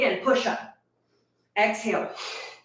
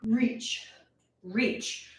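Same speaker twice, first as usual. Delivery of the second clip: soft slow cue; 9 dB quieter, much slower than usual. Shown as a demonstration. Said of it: "If you need to put the bell down".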